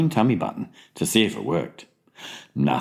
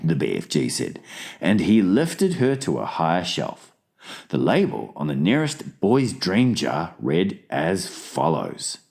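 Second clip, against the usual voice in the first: usual speaking voice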